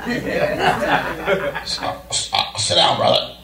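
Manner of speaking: stammered breathy mock pastor; lightly voiced stuttering old pastor; a hint of Pastor Hart stammering; imitating Pastor Hart. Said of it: "I don't know that's how he sounded".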